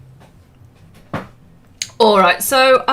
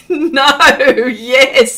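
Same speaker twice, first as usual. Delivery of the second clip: reading Arnie's reply through laughter; louder, giggling as you read it; laughing; 7 dB louder than usual. Retold or imitated. Says Arnie.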